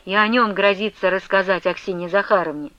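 ordinary delivery

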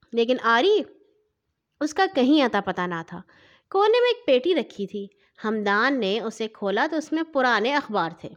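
ordinary delivery

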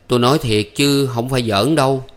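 ordinary delivery, nothing marked

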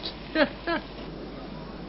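soft chuckle. Real, not acted. Poirot laughs at skeleton joke.

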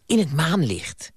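excited. A narrator's reading. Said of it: "zei ze opgewonden".

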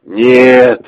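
sharp loud scream; cry out. Acted. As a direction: yell it out in horror. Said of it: "вскрикнул Артём".

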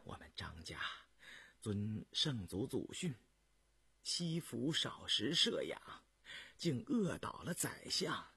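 slow, soft, halting murmur; hushed, feeble joking; weak self-mockery. A narrator's reading. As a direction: make light of it faintly. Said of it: "又笑说".

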